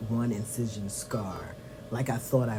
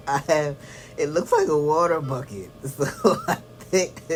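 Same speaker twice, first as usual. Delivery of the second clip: laughing, voice jumping high and loud; giggling at how silly it looks; 5 semitones higher, 6 dB louder than usual. Real, not acted. Laughing about the water bucket.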